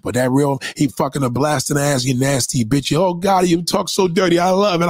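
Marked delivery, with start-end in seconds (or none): 3.0-4.9 breathless mock ecstasy, raised pitch; aroused woman. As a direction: ham up a woman turned on. Acting woman aroused by real man.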